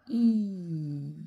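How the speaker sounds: very long, loud "Ir", i gliding into held r; syllabe liée. Shown as a demonstration. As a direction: stretch "Ir": long eee, then a sustained throaty r. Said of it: "Je ne m'arrête pas entre le i et le r". (« Ir » très étiré et fort, i puis r prolongé)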